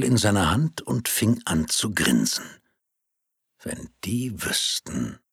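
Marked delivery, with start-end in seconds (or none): 3.6-5.2 slow, soft inner voice; a private, amused thought; thinking aloud. Acted. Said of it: "dachte er bei sich".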